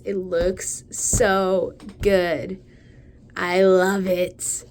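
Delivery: loud, very slow gushing; excited. Real, not acted. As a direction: stretch every word with delight. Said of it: "I got a little excited".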